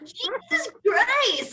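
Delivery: drawn-out high exasperated cry. Real, not acted. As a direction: stretch it out, aghast. Stunned exclamation.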